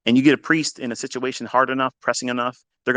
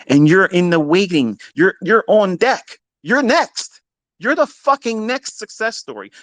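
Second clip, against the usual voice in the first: higher and louder, building hype; rousing build; 7 semitones higher, 3 dB louder than usual. Real, not acted. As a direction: pump the listeners up. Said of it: "rallying audience".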